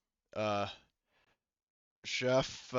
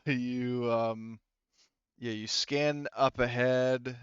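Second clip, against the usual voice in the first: usual speaking voice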